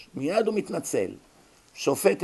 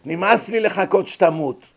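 loud, brazen demand for the money; demanding heir; 5 semitones higher, 8 dB louder than usual. Acted. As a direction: push the old uncle to pay up now. Greedy heir demanding inheritance.

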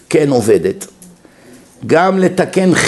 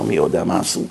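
inner thought; 10 semitones lower, 7 dB quieter than usual. Retold or imitated. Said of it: "הוא חושב כבר".

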